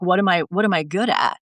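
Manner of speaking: normal talking voice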